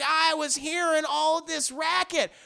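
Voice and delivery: put-on high, slow old-lady voice; Rosie. Elderly neighbor asks about all this racket, high old-lady voice. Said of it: "their elderly neighbor, and she's like".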